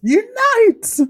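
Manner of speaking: very high, loud call; mock rallying cry. Joking with a co-host, mock rallying cry. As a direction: shout it like a playful battle cry.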